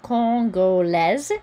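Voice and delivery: Congolaise slow, nasal con, S as Z; careful French Congolaise; French pronunciation. Shown as a demonstration. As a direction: sound out Congolaise with a Z. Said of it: "this sounds like a Z".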